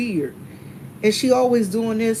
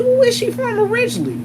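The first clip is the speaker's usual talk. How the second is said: incredulous question; 13 semitones higher, faster than usual. Retold or imitated. Narrator questioning secretary, disbelieving.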